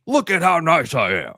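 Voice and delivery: high, put-on smug show-off voice; mock show-off. Mocking a co-host who bragged about paying, in a smug high voice. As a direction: play a braggart proud of his own kindness.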